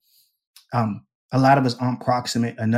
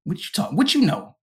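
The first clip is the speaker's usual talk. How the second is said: challenging classmate; 7 semitones higher than usual. Retold or imitated. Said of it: "the kid was like".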